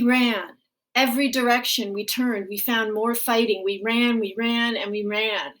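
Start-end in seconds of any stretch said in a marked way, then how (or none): none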